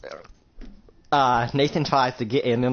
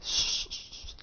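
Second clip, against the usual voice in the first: hushed shush in his face; whispered shushing; 12 dB quieter, slower than usual. Retold or imitated. Connor shushing Nathan before the sandwich, whispering. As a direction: whisper him quiet.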